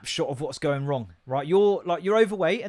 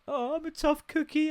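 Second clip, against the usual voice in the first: put-on much higher sleepy toddler voice; 13 semitones higher than usual. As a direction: sound like a confused little girl. Daughter voiced in story.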